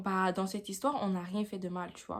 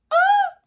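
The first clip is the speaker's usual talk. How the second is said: shocked shriek; 16 dB louder than usual. Acted. Reacting to the insult.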